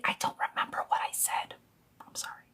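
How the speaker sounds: whispered confession aside; sheepish whispered admission. Real, not acted. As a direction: breathe it under your voice.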